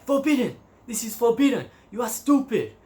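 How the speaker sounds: high-pitched French officer's scolding; mock angry policeman. Policeman scolding a hitchhiker on the highway, high mock outrage.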